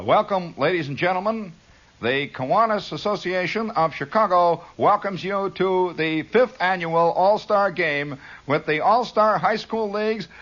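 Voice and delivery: high, formal PA announcement; stadium announcer. Retold or imitated. Teenager announcing all-star game to crowd, high announcer voice. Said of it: "the first thing that I said into this microphone".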